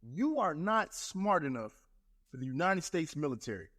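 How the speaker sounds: slow, deliberate, each word laid out; slow blunt statement. Shown as a demonstration. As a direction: spell it out slowly to the parents. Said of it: "you're gonna have to talk like this".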